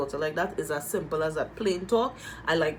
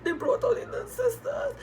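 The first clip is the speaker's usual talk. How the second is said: crying out; 4 dB louder than usual. Real, not acted. Breaking down at sisters' arrival.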